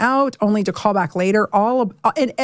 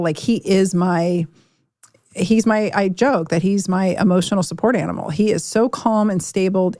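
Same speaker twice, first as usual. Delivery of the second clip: usual speaking voice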